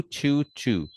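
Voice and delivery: "chew" said as a rounded ch-oo; chew accent demo. Shown as a demonstration. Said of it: "say chew".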